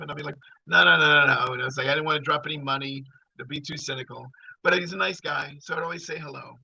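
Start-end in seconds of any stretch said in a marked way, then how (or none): 0.7-1.5 a burst of loud, repeated no's; emphatic refusal. Retold or imitated. Turning down the street psychic, a loud insistent no. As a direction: wave him off loudly, no after no.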